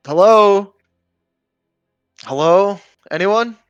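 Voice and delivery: calling out into empty alleys, high and loud; lost man shouting for anyone. Acted. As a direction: yell hello down the alleys, slow and drawn out. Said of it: "I'll start shouting throughout the alleyways".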